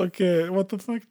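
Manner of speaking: drawn-out incredulous okay, what the fuck; mock baffled. Reacting to the guests' story, slow baffled disbelief. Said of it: "Ich dachte so".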